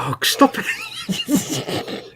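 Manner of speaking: squealing high through laughter; cracking up while telling him to stop; laughing protest. Real, not acted. Co-host laughing at his yawning, high squeal.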